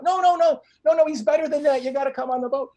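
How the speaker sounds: high, loud rush of objections; eager insistence. Retold or imitated. Friend talks the doubtful ladies aboard, eager insistence. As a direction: override their doubts with excited insistence.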